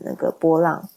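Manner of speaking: ordinary talk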